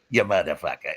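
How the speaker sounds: low, lightly imitated disgusted curse; a hint of the promoter's low grumble; Sparta impression. Sparta to the man mimicking him, low mock curse. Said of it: "shaking his head with that look".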